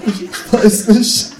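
high, loud, drawn-out wail; anguished cry. Acted. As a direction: wail it slowly, as if under torture. Erzähler wird vom Känguru gequält. (gequältes, gedehntes Jammern)

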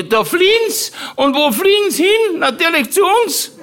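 put-on very high, indignant old aunt, fast and laughing; Tante Mari nachgemacht. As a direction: squeal the aunt's outrage. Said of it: "weil die Tante Mari gesagt hatte". (sehr hohe, empörte Tantenstimme, lachend)

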